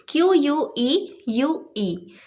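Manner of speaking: letters one by one, slowly; spelling out letters. Shown as a demonstration. Teacher spelling the word for students, slow. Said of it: "next word है Q".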